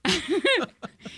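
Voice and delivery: real high-pitched laughter; high giggle. Real, not acted. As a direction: burst into giggles. Laughing at the memory.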